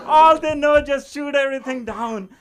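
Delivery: loud, high, worked-up delivery; loud excited telling. Retold or imitated. Comic telling the audience what coworkers think, loud and gleeful. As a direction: shout it out with glee.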